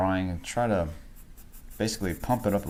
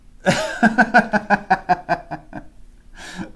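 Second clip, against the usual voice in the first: loud laugh; 13 semitones higher, 8 dB louder than usual. Real, not acted. Streamer bursts out laughing at a chat message read aloud, loud laugh. Reacting to funny TTS message.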